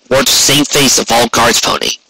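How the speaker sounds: ordinary delivery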